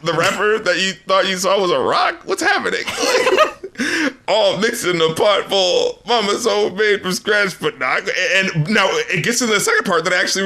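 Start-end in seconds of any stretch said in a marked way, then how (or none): 2.2-4.3 high laugh; laughing confusion. Real, not acted. Host laughing at nonsense lyrics, high. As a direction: crack up confused.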